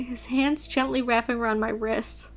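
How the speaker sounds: normal talking voice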